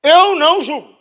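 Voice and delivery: high, loud preaching shout; fiery preacher's declaration; proclaimed shout. Acted. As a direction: cry it out to the church.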